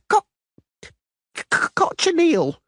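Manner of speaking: slow, high stammer on the first sound; nervous stutter. A narrator's reading. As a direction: stumble over the c before cochineal. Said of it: "the Doppler stuttered".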